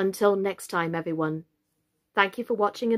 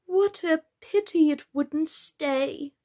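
higher, slow, drawn-out regret; sighing; 7 semitones higher, slower than usual. A narrator's reading. Lory lamenting the mouse's departure, sighing. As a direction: sigh it out regretfully, stretching the words. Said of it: "sighed the lorry".

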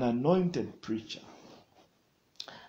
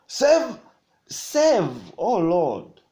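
cry to God; 2 semitones higher, 7 dB louder, much slower than usual. Acted. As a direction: cry out the prayer to heaven. Cries out prayer.

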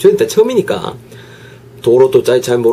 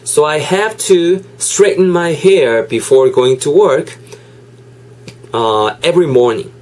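slow, deliberate English reading; slow example read; much slower than usual. Shown as a demonstration. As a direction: read it slowly for learners. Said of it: "reads example sentence".

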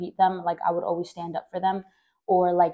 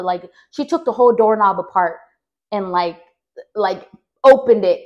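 usual speaking voice

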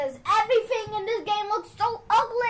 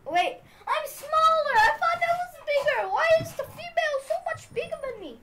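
high-pitched, rushed disbelief; indignant dismay; 9 semitones higher, 3 dB louder, faster than usual. Real, not acted. Player finding the female is bigger, dismayed. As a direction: protest in a higher, quickening voice.

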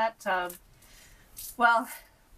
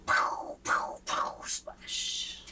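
whispered sound effects; much slower than usual. A narrator's reading.

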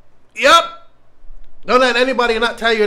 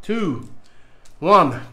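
usual speaking voice

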